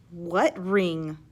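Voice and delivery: usual speaking voice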